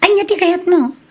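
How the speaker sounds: high indignant outburst; wife's high-pitched indignant retort; indignant exclaim. Acted. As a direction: react high and put out. Wife snapping at husband.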